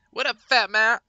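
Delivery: loud, high-pitched holler hello; shouted greeting. Real, not acted. Streamer greeting a friend in game, loud shouted hello. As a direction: call out to a buddy, high and loud.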